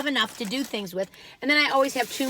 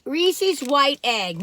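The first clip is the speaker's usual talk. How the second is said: slow, drawn-out name; slow product announcement; 3 semitones higher, 4 dB louder, much slower than usual. Real, not acted. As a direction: present the product slowly. Announcing the next product slowly.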